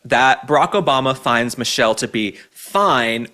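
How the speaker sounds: ordinary delivery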